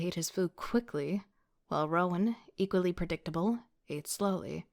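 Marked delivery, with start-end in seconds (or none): none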